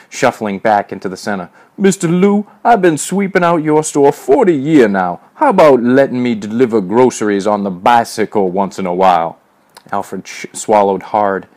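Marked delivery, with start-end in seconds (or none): 1.8-9.4 high, loud, pleading mock servant; old-time servant imitation. A narrator's reading. Major as old Alfred begs the boss, mock servile.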